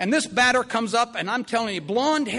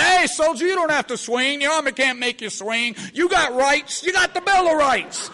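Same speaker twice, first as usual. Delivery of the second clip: louder, higher, lecturing the batter; indignant lawyer; 4 semitones higher, 5 dB louder than usual. Retold or imitated. Lawyer patient protesting to the batter, louder indignant lecture. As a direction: play the barracks lawyer defending rights. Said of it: "He would say".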